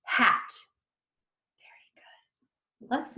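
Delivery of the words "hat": "Hat" short and whole; fast like a word. Shown as a demonstration. Teacher finishes blending for children, "Hat" fast as a word. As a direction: say "Hat" quickly in one go. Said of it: "Fast like a word".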